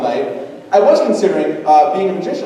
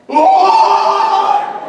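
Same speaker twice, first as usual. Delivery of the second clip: a very high, loud shriek of shock; shrill panicked scream; 7 dB louder than usual. Retold or imitated. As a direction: shriek like you just saw a ghost. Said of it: "something along the line of".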